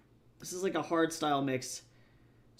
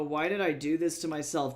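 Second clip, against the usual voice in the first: normal talking voice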